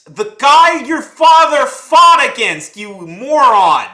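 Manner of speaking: slow, high, loud furious yell; dragged-out yell of real anger; furious shout. Real, not acted. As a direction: scream it at him, slow and enraged. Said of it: "Sorry, this gets me mad".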